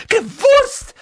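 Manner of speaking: very high, loud outburst on GEWUSST; sudden shriek. A narrator's reading. Aunt exploding at Harry's question, shrieking. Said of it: "schrie Tante Petunia plötzlich auf".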